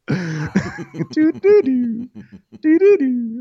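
high sung chime notes; doorbell melody. Retold or imitated. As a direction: chime it out like a bell. Doorbell sound imitation.

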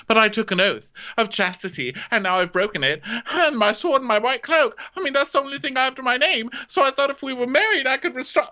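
high whiny protest, climbing as it goes; mock distraught knight. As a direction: plead hammily over lost honour. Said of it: "He goes".

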